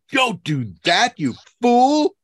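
high, loud screeched scolding; drawn-out shrieking rebuke, acted out; imitated scream. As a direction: screech it slowly, like an outraged spider. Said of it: "She screamed at me".

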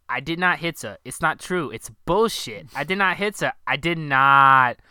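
loud, rising mock outburst of denial; Tommy's angry denial. Quoting Tommy's rooftop line, a hammed-up shout. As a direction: bellow the denial like a wounded man. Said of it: "he says".

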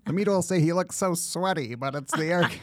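a clumsy Trump, half laughing; bad Trump impression. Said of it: "that was my horrible Trump accent".

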